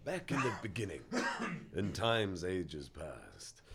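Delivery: slow, low, grand storytelling voice; epic storyteller. Acted. Host beginning his life story as a mock epic tale. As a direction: intone it like an ancient saga.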